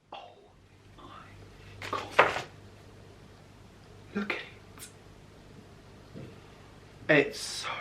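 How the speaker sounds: halting, breath-only whisper of awe; awestruck. Real, not acted. Vlogger's first look at the new phone, awestruck whisper.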